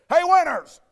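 high, loud call across the gym; imitating a coach's sharp yell; coach's shout. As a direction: holler it like a coach catching a player out. Coach calls out player after stray shot.

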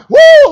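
high, loud whoop; victory whoop. Acted. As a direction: shout it like a winning crowd. Israel celebrating victory.

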